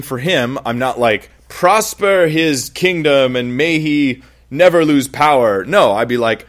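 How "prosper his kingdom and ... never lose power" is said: slow, louder, hammed-up blessing; mocking a wrong prayer for Hitler, slow and grand.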